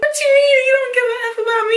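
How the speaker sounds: high, rapid mock wail; indignant retort. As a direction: fire back in hammed-up, high-pitched disbelief. Acted indignant comeback to boyfriend.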